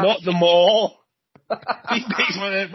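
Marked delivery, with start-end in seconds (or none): 0.1-1.0 high, loud-edged "not them all"; exasperated correction. Retold or imitated. Punchline correction in acted argument.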